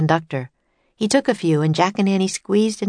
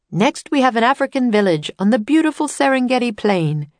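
higher, louder showman announcer; tour guide announcer; 6 semitones higher, 4 dB louder than usual. Acted. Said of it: "said the tour guide".

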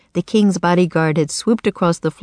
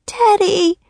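put-on very high girlish cry; alarmed cry; 4 dB louder than usual. A narrator's reading. Girl sees her friend caged, crying out high. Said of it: "cried Annie".

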